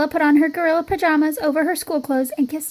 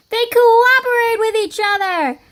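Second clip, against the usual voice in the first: high, loud, slow call; shout; 8 semitones higher, 4 dB louder than usual. A narrator's reading. Girl answering the teacher at the zoo, high proud shout. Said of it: "Priscilla shouted".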